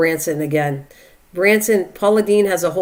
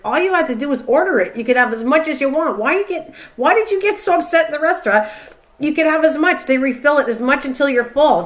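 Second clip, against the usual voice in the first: higher, exasperated explaining to mom; retold exasperated scolding; 7 semitones higher than usual. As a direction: lecture her mom, incredulous and a bit fed up.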